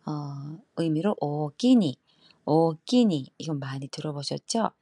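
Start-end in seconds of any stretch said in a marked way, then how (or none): 1.2-3.3 louder Kyoto 오키니; Kyoto dialect. Shown as a demonstration. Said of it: "교토 쪽은 특히나".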